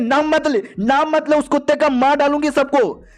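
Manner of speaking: high-pitched furious yelling at the sister; angry outburst. Acted. Mother rages at her little sister over Feng, angry. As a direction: snap it out, she won't hear the name. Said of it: "इतने गुस्से में क्यों हो?".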